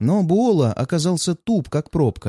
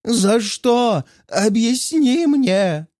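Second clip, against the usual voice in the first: much higher, very slow theatrical wail; mock desperate cry; 9 semitones higher, much slower than usual. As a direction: wail it like a tragic actor. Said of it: "с отчаянием кричу".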